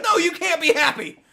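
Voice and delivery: loud, high killjoy imitation; mock scolding officials. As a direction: shout a ridiculous ban as the league. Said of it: "they were like".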